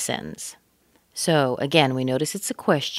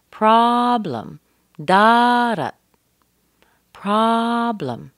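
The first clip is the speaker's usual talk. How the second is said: PROB high, loud and long, weak fall after; long strong first syllable; 7 semitones higher, 6 dB louder, much slower than usual. Shown as a demonstration. Teacher drills strong then weak syllable, stressing PROB in "problem". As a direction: belt the first syllable of "problem", let the second fall away. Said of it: "pra is very long and strong".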